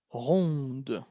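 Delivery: soft unvoiced repeat of "ronde"; breathy demo of "ronde", D at the end; whispered model word. Shown as a demonstration. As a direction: whisper "ronde" with the final D sounded. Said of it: "Vamos a escuchar una T al final".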